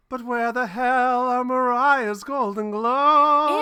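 loud high slow cry of outrage; mock outrage. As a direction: wail it in theatrical dismay. Intro sketch punchline.

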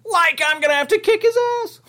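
high goofy Shaggy voice; nervous cartoon slacker, high up. Still doing Shaggy voice.

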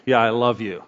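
low, perfunctory reply; offhand. Shown as a demonstration. Showing how tone changes words, offhand. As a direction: toss it off without meaning it. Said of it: "or I can be like".

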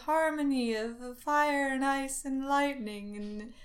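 mock holy chant, slowed; pseudo-religious voice. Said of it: "kinda pseudo-religious voice".

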